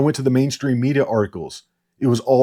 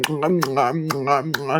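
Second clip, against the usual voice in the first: mock humming; 3 semitones higher, slower than usual. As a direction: hum it like a dry-mouthed nervous tic. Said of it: "She's like".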